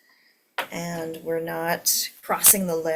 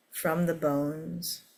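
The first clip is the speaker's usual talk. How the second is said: guided meditation. Acted. Leads a meditation.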